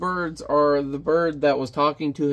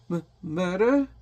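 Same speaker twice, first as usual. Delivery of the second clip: higher, drawn-out stammered question; stammering shock; 4 semitones higher than usual. Acted. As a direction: play a mildly frightened, disbelieving servant. Frightened servant queries the order.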